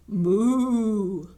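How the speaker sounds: loud put-on moo; mooing hen. Hen moos in the story.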